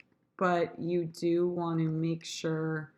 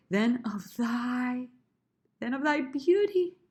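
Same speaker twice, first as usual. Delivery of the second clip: crescendo; 7 semitones higher than usual. Shown as a demonstration. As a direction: lift and swell it toward BEAUTY. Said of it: "gonna be my crescendo".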